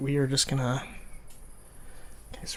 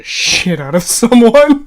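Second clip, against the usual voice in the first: laughing; 10 semitones higher, 15 dB louder, slower than usual. Real, not acted. Laughing at violent story.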